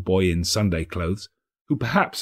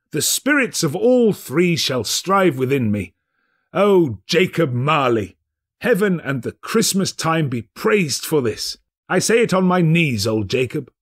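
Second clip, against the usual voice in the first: put-on an old man praising heaven on his knees; overjoyed, earnest old miser; fervent Scrooge; 6 semitones higher than usual.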